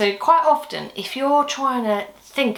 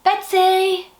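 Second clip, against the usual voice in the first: high, loud call to a friend off-stage; 11 semitones higher, 6 dB louder than usual. Acted. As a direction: shout her name up high. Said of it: "shall we shout for Betsy then?".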